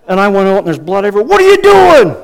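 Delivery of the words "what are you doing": very high, loud mock-angry shout; yelling dad. Dad seeing blood everywhere, shouting at his son. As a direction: blow up like a dad chewing out his kid. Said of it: "he yelled".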